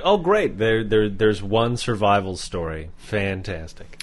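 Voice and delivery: deadpan mock praise, trailing off; sarcastic. Mocking a friend's one survival story, slow sarcasm. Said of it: "I'm like".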